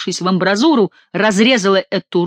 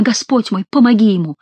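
usual speaking voice

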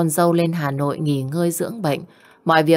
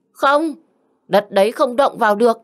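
a sharp, much higher no, then firm; mother-in-law refusing her husband, sharply higher; flat refusal; 6 semitones higher than usual. Acted. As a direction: slam the idea down hard. Mother-in-law refuses to sell land.

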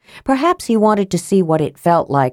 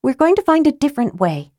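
higher and fast, firm; a lightly voiced grim Tika; 9 semitones higher, faster than usual. A narrator's reading. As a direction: insist through set teeth. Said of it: "said Tika grimly".

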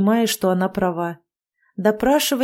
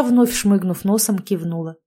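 plain reading voice

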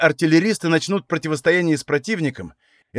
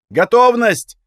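higher, louder battle call; shouted command; 6 semitones higher, 6 dB louder, slower than usual. A narrator's reading. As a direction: call out the ready order. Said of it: "женский крик позади".

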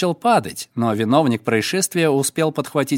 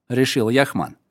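plain reading voice

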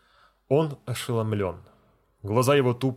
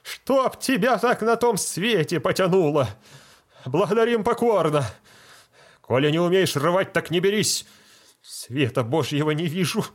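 much higher, loud outraged scolding; furious deacon; 7 semitones higher, 4 dB louder than usual. Acted. Deacon scolding the feldsher, much higher and louder. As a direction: curse him for botching it.

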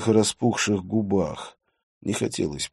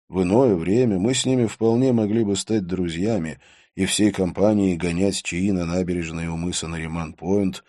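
usual speaking voice

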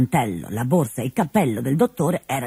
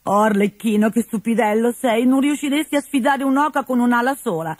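lightly voiced teasing Colombina; 8 semitones higher than usual.

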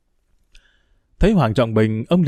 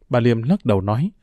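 usual speaking voice

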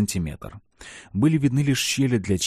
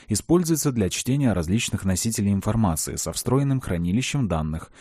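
plain reading voice

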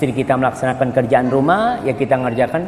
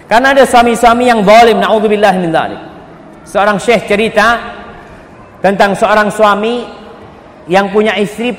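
raised, ringing preacher's voice; preaching at full force; 7 semitones higher, 4 dB louder than usual. Acted. Preacher telling the congregation of a cruel husband, at full force.

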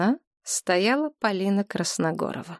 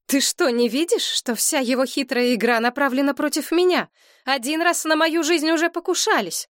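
agitated Galina; 8 semitones higher than usual. A narrator's reading. Galina arguing with mother.

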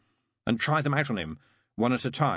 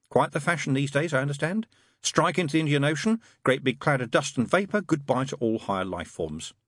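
higher, lively demon voice; lightly voiced demon reeling off a scenario; demon character, breezy; 7 semitones higher, 3 dB louder than usual. Demon describing an asteroid strike to the angel, lightly breezy.

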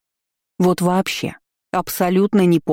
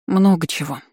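tired. A narrator's reading. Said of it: "устало ответила я".

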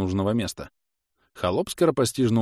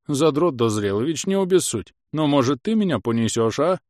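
pleading house spirit; 6 semitones higher, 4 dB louder than usual. A narrator's reading. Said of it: "взмолился домовой".

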